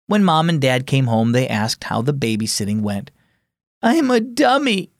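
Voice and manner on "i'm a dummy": whimpering. A narrator's reading. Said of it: "Colton whimpered".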